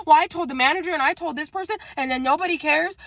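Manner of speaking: fast, high-pitched rambling grievance; mimicked complaining coworker. Coworker moaning about a restaurant steak, fast high mimicry. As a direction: rattle off a petty complaint way up high.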